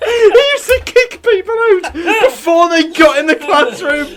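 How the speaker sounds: high, slowed words through laughter; laughing disbelief. Real, not acted. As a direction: squeal the story out while laughing. Laughing while retelling.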